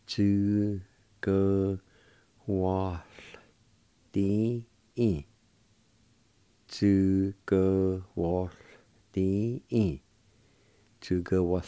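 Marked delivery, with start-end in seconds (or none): 0.1-4.6 each syllable of "Chữ cơ hỏa tín" alone, with pauses. Shown as a demonstration. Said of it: "with W A like this". 6.7-10.0 "Chữ cơ hỏa tín" slowly, each syllable drawn out; slow pronunciation demo. Shown as a demonstration. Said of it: "with W A like this".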